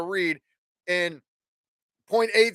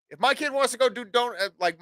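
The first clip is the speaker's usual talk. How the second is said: ordinary talk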